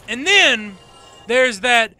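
ordinary talk, nothing marked

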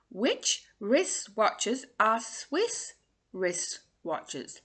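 very slow, drawn out; slow tongue twister. Shown as a demonstration. Coach reading the twister slowly before the fast try. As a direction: take it word by word. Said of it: "Try saying that fast".

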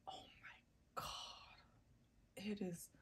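barely voiced, swooning over a scent; awed whisper. Real, not acted. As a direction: whisper it as if overcome. Overcome by the scent.

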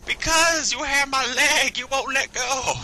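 high, slow, drawn-out whine; hammed-up helpless complaint; mock victim wail. Acted whining victim reply.

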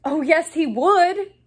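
high, loud contradiction; loud retort. A narrator's reading. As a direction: argue back loudly. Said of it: "even more loudly than Dean".

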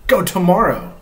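reading a picture book aloud, voicing Gerald; reading to children. Dad reads Mo Willems aloud to viewers with Chloe, storytime voice.

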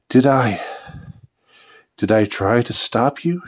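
halting, tentative question with a restart; faltering question, long pause mid-way; noticeable hesitation. A narrator's reading. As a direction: hesitate after the first words, then ask. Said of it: "with noticeable hesitation".

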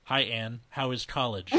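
deadpan everyday voice; normal monotone. Acted. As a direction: say it level, without any lilt. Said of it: "says in a normal monotone voice".

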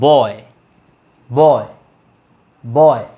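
বয় as one low syllable, repeated with pauses; each বয় a separate falling beat; single-beat demonstration. Shown as a demonstration. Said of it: "একবার".

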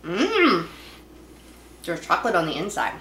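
chewing while talking; savoring a sweet bite; mouth full of cookie. Real, not acted. Seen 1: Bites cookie at -2; holds bitten cookie, mouth closed chewing while speaking.

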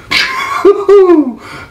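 high hooting laugh; amazed laugh. Real, not acted. Storyteller amazed at partner's rampage, hooting laugh. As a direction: hoot in disbelief.

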